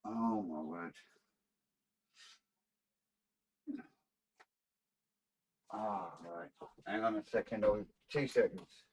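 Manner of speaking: straining at a stuck lid; talking while twisting a tight jar; opening a stuck jar. Real, not acted. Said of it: "Couldn't get the bloody lid off".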